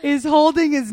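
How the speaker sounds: high, loud words broken by laughter; giggling out the description; laughing while talking. Real, not acted. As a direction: laugh through the words, delighted. Gushing over baby photo.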